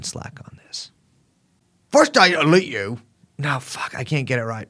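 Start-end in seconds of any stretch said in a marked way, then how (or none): 1.9-3.0 bizarre English-American accent; put-on transatlantic mishmash accent; Andrew Tate. Shown as a demonstration. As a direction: attempt a muddled half-English, half-American accent. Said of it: "impression of Andrew Tate".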